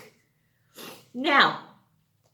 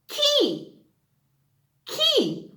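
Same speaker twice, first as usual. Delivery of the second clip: "Key" high and drawn out; example word called out high. Shown as a demonstration. Teacher reading game words, high and bright. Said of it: "when you hear a word".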